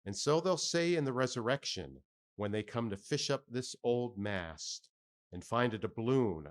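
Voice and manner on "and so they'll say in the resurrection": ordinary delivery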